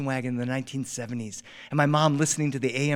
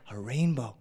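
soft, low, reverent murmur; prayerful incantation; 4 semitones lower, 6 dB quieter than usual. Retold or imitated. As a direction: say it like a little prayer. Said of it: "like an incantation, like a prayer".

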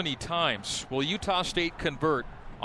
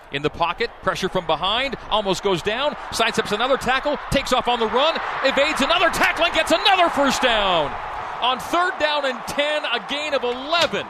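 rising, faster, louder live call; excited play-by-play; 8 semitones higher, 7 dB louder than usual. Real, not acted. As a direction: build the call as the run breaks. Live call of a big scramble.

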